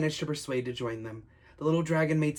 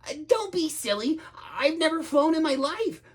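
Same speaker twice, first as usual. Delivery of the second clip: high, timid dragon voice; flustered, scared little dragon; fearful protest; 10 semitones higher than usual. Acted. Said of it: "who was quaking with fear".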